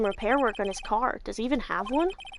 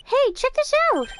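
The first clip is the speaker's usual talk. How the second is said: excited; 12 semitones higher than usual. Acted. Excited discovery.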